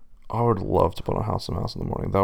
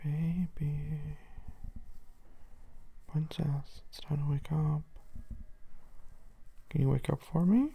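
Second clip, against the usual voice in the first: soft, gentle coaxing; soft wake-up voice; 5 semitones higher, 9 dB quieter, slower than usual. Real, not acted. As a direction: coax someone awake softly. Gently waking sleepy partner.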